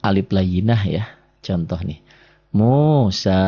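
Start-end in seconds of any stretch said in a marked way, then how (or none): 2.5-3.4 Musa with both vowels drawn out; slow model reading of Musa, held long. Shown as a demonstration. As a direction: stretch the final a of Musa. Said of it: "di sini jadi panjang".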